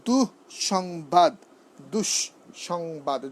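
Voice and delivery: "দুঃসংবাদ" very slow, split as dush, shongbad; doubled s, broken apart. Shown as a demonstration. Teacher dissecting the double sound, "দুঃসংবাদ" very slowly in parts. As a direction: break "দুঃসংবাদ" after du to show both sibilants. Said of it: "দন্তস্ব দুবার উচ্চারণ হয়েছে".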